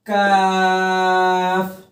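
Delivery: teacher contrasts thin kaf with heavy qaf; thin light kaf. Shown as a demonstration. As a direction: draw out काफ lightly, never heavy. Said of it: "बारीक आवाज में".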